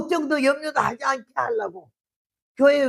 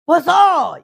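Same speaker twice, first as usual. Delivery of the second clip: loud yell, higher, calling across the house; brash wife's call at full volume; yelling for husband; 6 semitones higher, 9 dB louder, much slower than usual. Retold or imitated. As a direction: bellow for the husband from the door. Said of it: "근데 왜 이렇게 소리 질러?".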